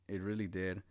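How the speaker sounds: ordinary talk, nothing marked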